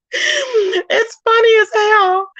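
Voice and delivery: high, slow words squeezed through laughter; real laughing fit, pitch way up; cracking up. Real, not acted. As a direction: laugh helplessly while talking. Cracks up recalling Kwani's remark.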